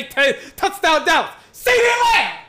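high, loud, laughing shout; thrilled fan yelling the name; excited shout. Real, not acted. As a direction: burst out the name like a fan losing it. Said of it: "I'm so excited to say".

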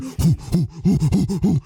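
quick rhythmic hoo-hoo puffs; imitated sprint huffing. Host mimics Bruce sprinting like crazy, rhythmic huffing. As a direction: puff out a mock running noise.

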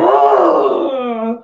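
loud indignant shout; outraged cry. Retold or imitated. Mother outraged at daughter.

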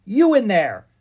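put-on loud, high young girl's demand; girlish, demanding call. A narrator's reading. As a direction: call sharply to someone shut inside. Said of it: "it demanded".

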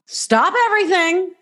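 hammed-up panicked manager stopping everyone; mock panic. Mock panic over broken glass.

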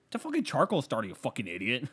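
exaggerated know-it-all exasperation; mock scorn. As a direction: blurt it as if it were obvious. Imitates friend's scornful answer.

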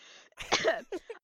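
loud dry cough; harsh real cough; coughing fit. Real, not acted. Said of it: "I'm coughing".

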